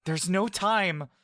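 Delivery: high, slow, urgent call; urgent shout. Acted. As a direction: cut the argument short. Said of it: "the human shouts".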